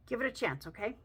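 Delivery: ordinary talk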